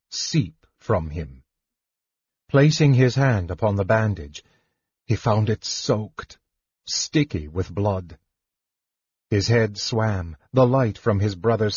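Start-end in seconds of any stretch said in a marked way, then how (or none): none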